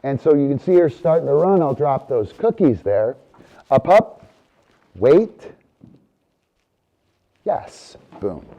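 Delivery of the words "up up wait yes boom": loud dog cue. Real, not acted. Cueing the dog.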